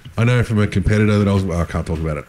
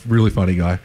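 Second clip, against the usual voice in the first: usual speaking voice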